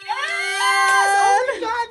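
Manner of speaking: high, loud squeal of joy; excited cheer. Real, not acted. Host cheering after a great interview, excited squeal. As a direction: cheer with real delight.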